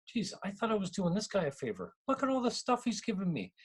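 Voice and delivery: high-pitched pleasant disbelief; amazed. Retold or imitated. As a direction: voice a thought of happy astonishment.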